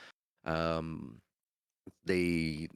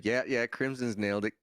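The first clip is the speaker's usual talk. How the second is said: ordinary delivery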